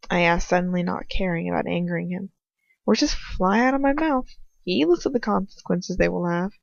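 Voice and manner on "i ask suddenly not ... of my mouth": ordinary delivery, nothing marked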